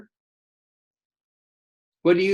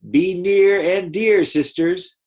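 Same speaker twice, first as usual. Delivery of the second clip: higher, louder, slow sisterly voice; her voice; 6 semitones higher, 6 dB louder, slower than usual. Shown as a demonstration. Teacher voicing Nimby calling to her sisters, high and slow. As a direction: sing it out sweetly to her sisters. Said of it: "she's gonna say it in her voice".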